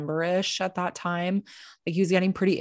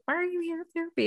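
higher, fast, put-on therapist voice; mock therapist; 12 semitones higher, 3 dB quieter, much faster than usual. Therapist asking why she's in therapy, mock puzzled. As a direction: imitate the puzzled therapist, quick and high.

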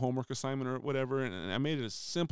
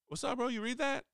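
much higher, eager call across the hall; cheerful call; 12 semitones higher than usual. Retold or imitated. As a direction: sing it out to catch him. Repeat greeting next day.

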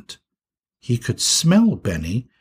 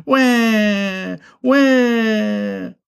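high, drawn-out bawl; cartoon baby crying; crying wail; 9 semitones higher, 4 dB louder than usual. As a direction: wail on like a toddler. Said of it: "didn't help him to stop crying".

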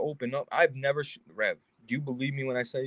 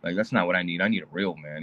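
lower, sneering mock girl; a lower, dismissive imitation of a woman; 7 semitones lower than usual. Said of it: "they're like".

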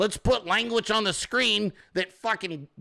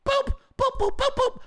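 high squeaky boop sounds; squeaky cartoon beeps; boop noises. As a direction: beep out the deletions like a cartoon. Mock sound of deleting broadcasts.

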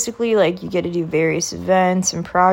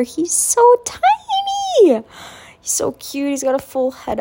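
cooing; 8 semitones higher, 3 dB louder than usual. Real, not acted. Cooing over baby nephew.

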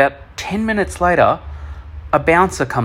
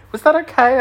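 high-pitched imitated woman's question; lifted, high imitation of the dancer; stripper checking in; 12 semitones higher, 3 dB louder than usual. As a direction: put on a high voice to ask politely. Voices the stripper afterwards.

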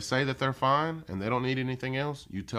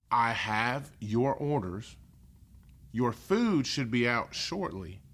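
slow model reading; slower than usual. Shown as a demonstration. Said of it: "slow model repetition".

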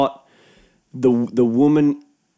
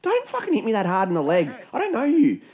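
exasperated; 11 semitones higher than usual. Retold or imitated. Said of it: "I'm like".

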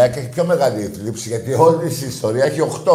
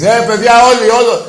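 excited cheer; 10 semitones higher, 9 dB louder than usual. Real, not acted. Cheering fans going to Amsterdam.

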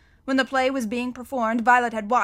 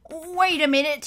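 a louder, higher fussy official; blustering banker; 5 semitones higher, 5 dB louder than usual. A narrator's reading. Mister Poe promising the children to call police. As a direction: reassure the children with brisk bluster.

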